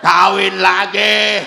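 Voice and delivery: loud, slow shout of kawin lagi; shouted demand. Acted. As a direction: bellow it like a stubborn husband. Mocking husband's demand.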